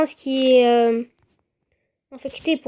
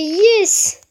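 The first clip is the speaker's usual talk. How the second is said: excited; 6 semitones higher, 5 dB louder than usual. Real, not acted. Cheering own game progress.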